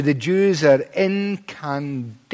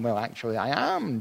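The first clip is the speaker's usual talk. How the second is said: low, much softer dry reply; understated aside; 4 semitones lower, 9 dB quieter than usual. Retold or imitated. Preacher imagining Jesus answering the ironic question, quiet aside.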